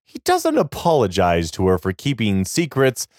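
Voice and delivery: ordinary delivery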